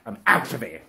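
usual speaking voice

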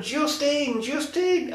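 high mock cries of a name; hammed-up high calling; imitated woman calling out. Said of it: "she keeps going".

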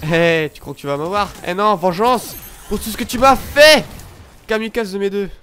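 loud gleeful taunt at the enemy; taunting revenge. Real, not acted. Player taunts the enemy who ambushed him, loud and mocking. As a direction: get your payback, loud and mocking.

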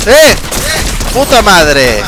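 shouted curse. Real, not acted. Frustration in game.

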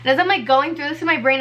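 normal talking voice

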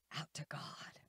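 hushed whisper to close the phrase; whispering out to God. Real, not acted. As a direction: breathe it out like a secret prayer. Preacher drops voice on climax.

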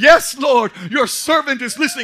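loud, high Yes Lord; eager answer. Acted. Samuel answering God, loud eager reply. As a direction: leap up and answer loudly.

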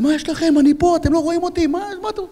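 very high-pitched outraged shout; re-enacted frantic protest; retold indignant yell. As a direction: yell at your own side in disbelief. Shouting at own troops firing at him.

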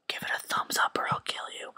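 unvoiced creepy whisper threat; mock-sinister whisper to viewers; menacing whisper. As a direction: hiss the threat close to the mic.

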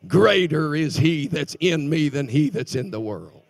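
loud, ringing scripture declaration; full-voice preacher proclaiming victory; loud proclamation. Acted. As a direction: belt the verse out. Preacher's climactic scripture cry.

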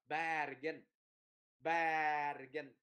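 "Bergen" said as "bar-ghen", long open "a"; đọc âm A. Shown as a demonstration. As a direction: slowly open up the first vowel of "Bergen" to "a". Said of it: "Mình đọc là". ("Bergen" đọc "bar", há rộng miệng)

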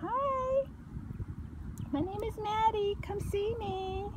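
high, soft puppy voice; voicing the dog. Owner voices the puppy greeting viewers, high and soft. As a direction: introduce her sweetly as the puppy. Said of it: "Say hi".

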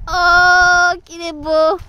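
high loud gushing; admiring. Real, not acted. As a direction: gush over the horse. Admiring horse.

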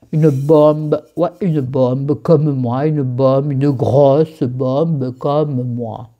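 put-on slow, drawn-out southern French accent. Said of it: "Je prends l'accent du Sud".

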